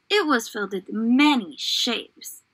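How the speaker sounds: usual speaking voice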